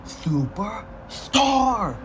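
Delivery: high, very drawn-out attack cry; mock yell. Hero unleashing his big attack, drawn-out mock yell. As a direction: stretch the move name high, held back in volume. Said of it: "as he'd yell".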